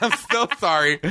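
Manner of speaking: high, mock-abject apology; confidence gone. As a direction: imitate a good guy suddenly mortified. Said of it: "confidence go from one hundred to zero".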